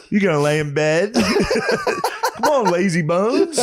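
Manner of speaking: high, laughing mock nag to a sleeping girlfriend; teasing wake-up call. Teasing his girlfriend awake, high laughing nag. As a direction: needle her out of bed, laughing. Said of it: "I woke up just like".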